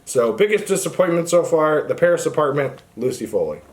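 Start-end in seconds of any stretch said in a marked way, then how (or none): none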